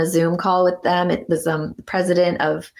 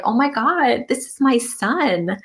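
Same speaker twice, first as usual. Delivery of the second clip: high, slow overwhelmed wonder; awed joy; 7 semitones higher, slower than usual. Retold or imitated. New mother first seeing her son, awed and high. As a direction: let the wonder slow you down.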